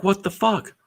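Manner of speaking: put-on wife finding drunks all over the garden, high and baffled; high shocked wife. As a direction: squeak out her shock at the yard. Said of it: "she's like".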